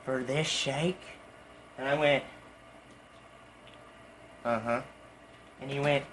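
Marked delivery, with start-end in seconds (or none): 0.1-1.0 quiet, understated mock menace; hushed imitation of a displeased customer; deadpan customer. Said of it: "looks at me dead in the eye". 4.4-4.8 low, quiet confirmation; small, lowered acted reply; deadpan. Deadpan reply to displeased customer.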